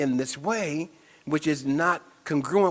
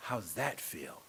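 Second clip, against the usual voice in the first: ordinary delivery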